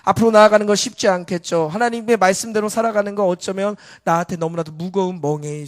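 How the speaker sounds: loud, emphatic sermon voice; forceful preaching. Real, not acted. Preacher telling congregation going forward is hard, forceful preaching. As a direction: exhort with strong, steady volume.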